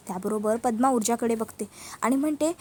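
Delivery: usual speaking voice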